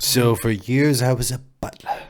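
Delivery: lightly put-on British butler; posh butler accent, hammed up. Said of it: "that's my other accent".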